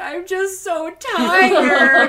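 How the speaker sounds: high, loud, drawn-out wail; mock crying. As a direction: imitate an exhausted friend's tearful meltdown, laughing. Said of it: "she just starts crying".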